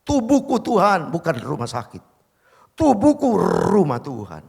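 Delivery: starts hushed, swells to a loud declaration; preacher praying over his own pain, building to a loud declaration; fervent prayer. Retold or imitated. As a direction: pray it rising into a loud commanding claim. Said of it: "Saya tumpangin tangannya".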